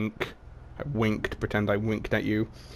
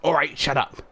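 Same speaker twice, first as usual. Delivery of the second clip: quick snap; 5 semitones higher than usual. Acted. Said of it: "Junkrat quickly said".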